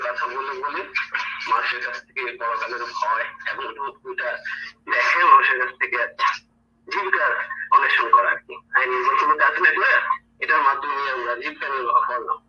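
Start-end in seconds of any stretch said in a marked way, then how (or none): 4.9-12.4 heated loud. Real, not acted. Heated argument against religion.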